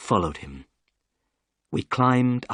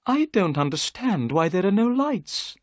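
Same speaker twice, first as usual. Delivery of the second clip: higher, eager gentleman's voice; Thaddeus Sholto; 9 semitones higher than usual. A narrator's reading. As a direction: voice the excitable host. Sholto's voice in the story.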